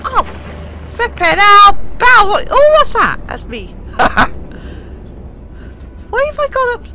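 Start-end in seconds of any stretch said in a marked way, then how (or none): 0.0-3.2 high, loud panicked outburst; alarmed shout. Real, not acted. Panicking at game mishap. 3.7-6.0 breathy helpless chuckle; airy laugh. Real, not acted. Laughing at own mistake.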